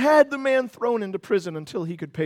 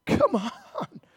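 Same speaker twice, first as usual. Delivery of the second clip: high-pitched, incredulous protest; exasperated disbelief; 7 semitones higher, 4 dB quieter than usual. Acted. Onlooker to the unforgiving servant, exasperated disbelief. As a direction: let the voice leap up in exasperation.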